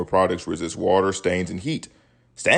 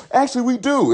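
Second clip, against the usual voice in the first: smug retort. Retold or imitated.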